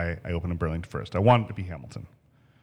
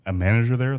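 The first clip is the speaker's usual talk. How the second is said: normal talking voice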